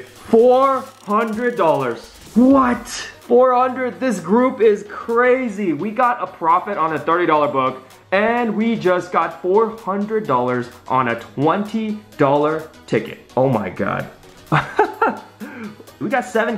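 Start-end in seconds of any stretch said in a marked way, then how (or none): 0.0-5.7 shrieking jackpot winner. Real, not acted. Realizing the four hundred win. 14.5-15.2 high giddy laugh. Real, not acted. After big win.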